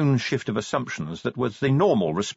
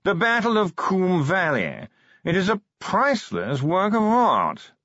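posh yawning voice; 8 semitones higher than usual. Acted. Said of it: "modulated yawning".

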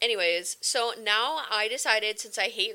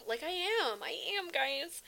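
higher, softer, slow reassurance; soft pleading; 6 semitones higher, 6 dB quieter than usual. Real, not acted. Assuring viewers she is trying, soft and higher. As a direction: quietly insist you really are trying.

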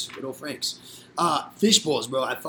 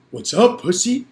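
louder, higher mock challenge; imitated taunt; 7 semitones higher, 9 dB louder, slower than usual. Storyteller voicing the rival's DM after the fight, taunting. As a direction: mimic a cocky provocation.

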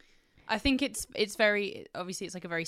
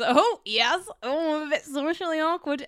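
a higher, louder put-on voice; mock Thirteenth Doctor; 10 semitones higher, 6 dB louder than usual. Mocking Jodie Whittaker's Doctor arc, higher put-on awkward voice. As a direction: play her as a flustered oddball. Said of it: "Jodie Whittaker's arc is".

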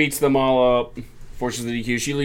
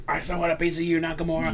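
higher, lightly voiced brawler taunt; a hint of a hothead held back; angry wrestler challenge; 6 semitones higher, 5 dB quieter, faster than usual. Seth Rollins calling out Nakamura, mild mock anger.

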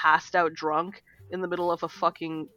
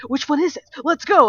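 higher and louder let's go; imitated hyped boyfriend; 9 semitones higher, 9 dB louder than usual. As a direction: pump it up like an eager nerd.

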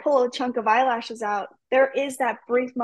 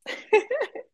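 high laugh; 12 semitones higher than usual. Real, not acted. Laugh after compliments.

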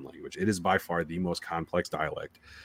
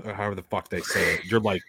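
ordinary talk

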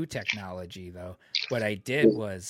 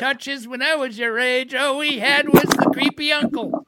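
put-on grumpy old man; 11 dB louder, faster than usual. Old man lecturing kids.

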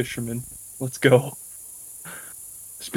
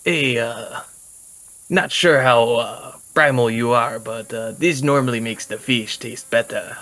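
put-on light Spanish accent, easygoing; lightly voiced Spanish accent; Spanish-accented character; slower than usual. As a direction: offer it like a friendly Spanish-accented traveller. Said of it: "I'll be like".

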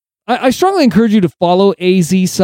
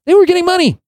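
indignant outburst; 13 semitones higher, much faster than usual. Real, not acted. Podcaster telling listeners legislators took Pfizer money, outraged high burst. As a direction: pitch jumps way up on "were getting money".

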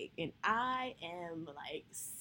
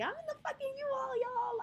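much higher, squealing with delight; excited shout; 3 dB louder than usual. Retold or imitated. Driving her first U-Haul to the new place, thrilled and much higher. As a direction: announce it like a victory.

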